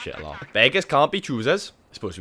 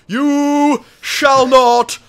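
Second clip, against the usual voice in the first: big booming slow shout; 10 semitones higher, 12 dB louder, much slower than usual. Pretend. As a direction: bellow it like a wizard. Blocking bridge, Gandalf quote.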